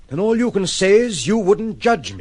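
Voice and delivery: sudden loud, high cry of disgust; bitter outburst. Acted. Man lashing out at the woman who pities him, loud and bitter. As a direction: flare up at him, hurt and bitter.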